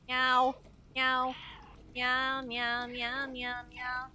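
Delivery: string of cat meows, loud then easing off; chattering cat meows, fading; repeated meowing. Pretend. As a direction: meow over and over like a cat.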